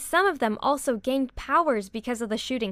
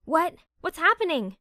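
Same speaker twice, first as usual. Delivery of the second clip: alarmed; 6 semitones higher than usual. A narrator's reading. Sudden alarm.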